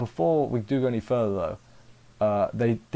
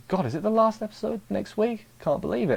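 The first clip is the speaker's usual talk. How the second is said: high-pitched, imitated astonishment; playful incredulous impression; mock disbelief; 8 semitones higher than usual. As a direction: quote him lightly, voice jumping up in disbelief. Acts character's disbelief about finale.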